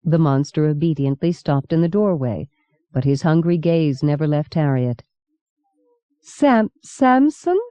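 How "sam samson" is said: weak voice. A narrator's reading. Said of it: "Harriet asked in a weak voice".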